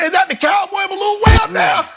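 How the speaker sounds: very high, hollering up at the sky; mock amazement. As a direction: squeal it as if spotting something far overhead. Mock wonder at soaring balloon.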